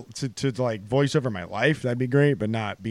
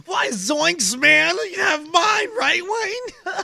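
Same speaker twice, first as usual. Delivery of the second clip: very high, cracking cartoon slacker; high-pitched goofy cartoon voice; Shaggy; 11 semitones higher, slower than usual. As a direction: squeak it out like a panicky cartoon stoner. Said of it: "Shaggy? What about Shaggy?".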